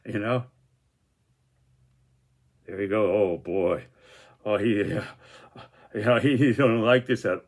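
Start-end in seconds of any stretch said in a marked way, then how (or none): none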